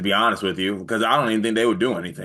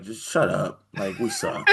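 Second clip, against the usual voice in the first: flat, fed-up teammate, breaking into a laugh; mock deadpan dismissal; 4 dB quieter than usual. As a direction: brush him off. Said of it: "everyone would be like".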